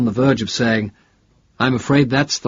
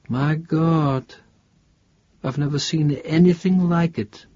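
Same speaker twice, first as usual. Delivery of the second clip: higher, understated awe; charged with excitement; 6 semitones higher than usual. A narrator's reading. Professor marvelling at strange metal, mildly charged with excitement. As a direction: let contained excitement lift the pitch. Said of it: "His voice was charged with excitement".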